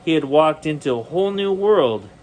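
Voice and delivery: ordinary delivery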